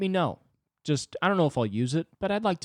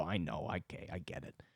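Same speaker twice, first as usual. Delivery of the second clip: soft sympathy; 5 semitones lower, 11 dB quieter than usual. Retold or imitated. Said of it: "And they go".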